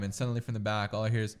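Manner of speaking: ordinary delivery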